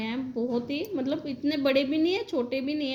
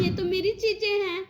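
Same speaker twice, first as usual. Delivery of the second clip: high, pleading objection; pitched-up retold protest to the mother; indignant protest; 6 semitones higher than usual. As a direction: push back, these are mine. Protesting her things being taken.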